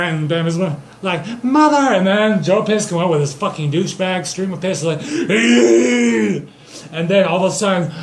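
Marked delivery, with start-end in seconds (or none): 1.4-1.9 high, loud wail; mock cry. Bambi's mother is brought back, mock high cry. 5.0-6.5 high, loud sustained "eee"; imitated squeal. Narrator voices the stream rushing in, high squeal.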